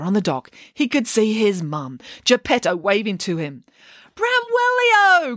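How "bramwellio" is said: high, slow call across the water; mother calling her son, high and drawn out; crying out. A narrator's reading. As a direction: call out to him from the dock. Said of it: "cried Geppetto".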